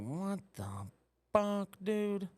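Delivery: high, slow, stretched what the fuck; shocked disbelief. Retold or imitated. Telling the avian flu news, high mock horror. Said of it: "I was like".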